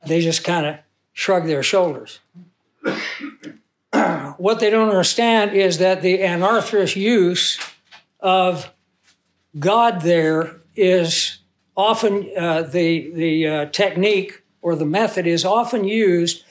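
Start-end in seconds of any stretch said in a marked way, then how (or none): none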